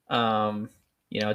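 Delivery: ordinary talk, nothing marked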